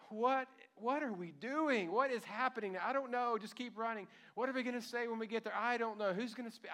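high, loud, out-of-breath questions tumbling out; frantic women running from the tomb, nervous and thrilled at once; breathless panicked chatter. Acted. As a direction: gasp it out like you're sprinting and scared. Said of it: "huffing and puffing and trying to get it out".

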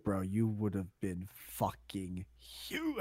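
slow, low lightly voiced cousin; slow, soft cousin voice. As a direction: drawl it like a laid-back cousin. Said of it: "my cousins always tell me".

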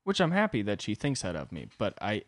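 normal talking voice

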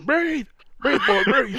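high hammed-up yell; mock shout. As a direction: shout like a movie medic. Mock rescue, yelling to breathe.

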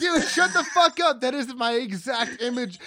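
high, loud burst of delighted disbelief; excited disbelief. Real, not acted. Host shouting in delight at his co-host, high and loud. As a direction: yell it because he read your mind.

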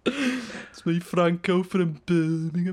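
slow mock Birmingham accent; exaggerated Birmingham impression. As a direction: introduce yourself in put-on Brummie. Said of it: "wakes up to a different accent".

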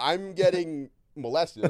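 exaggerated comic panic; mock alarm. Host playing the alarmed flirting target, loud mock panic. Acted alarmed reaction to the line.

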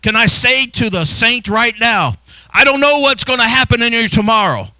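high, loud building sermon climax; fervent reassurance to the saints; preaching at full force. Acted. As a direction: build it up and land the final okay loud.

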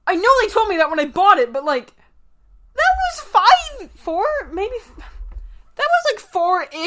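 high, fumbling homeowner voice; flustered homeowner. Acted. Homeowner explaining when he bought the house, high and flustered. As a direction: babble unsure about the date.

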